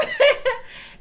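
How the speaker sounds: high burst of laughter; sudden squealing laugh; loud laugh. Real, not acted. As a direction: crack up out loud. Laughing at the scene.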